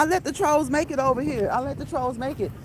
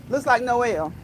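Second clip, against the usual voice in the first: ordinary talk, nothing marked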